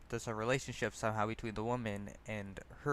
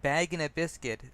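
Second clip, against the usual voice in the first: a lightly voiced drunk, higher and louder, rambling; eager drunk showing off his music knowledge; drunkard; 6 semitones higher, 7 dB louder than usual. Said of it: "the drunkard says".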